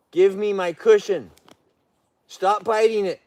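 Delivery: insistent stern order; firm scolding. Real, not acted. Owner ordering the bear to drop the cushion, firm. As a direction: lay down the law to the bear. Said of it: "See, talking firm with a bear".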